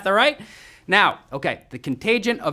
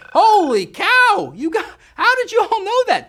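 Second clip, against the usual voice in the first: high, loud burst of astonishment; amazed; 11 semitones higher, 4 dB louder than usual. Real, not acted. Host amazed so many players knew it, high astonished shout. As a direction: exclaim it high, stunned by the result.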